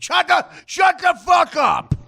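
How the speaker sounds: slow, high shout; imitated furious yell. Host imitating Uncle Bob yelling at the dog, long high shout. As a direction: bellow it drawn out at the dog. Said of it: "Uncle Bob's like".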